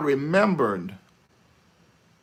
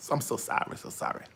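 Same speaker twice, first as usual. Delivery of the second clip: soft, unvoiced sorry; whispered apology; 3 semitones lower, 12 dB quieter, faster than usual. Real, not acted. Apologizing after gum remark.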